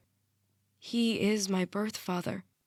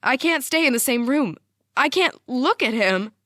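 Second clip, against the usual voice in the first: raised, loud and shaken; shaking voice; 5 semitones higher, 6 dB louder than usual. A narrator's reading. Mom can't bear to face dad, shaking voice. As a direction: push it out higher and louder, shaking. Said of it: "Her voice is shaking".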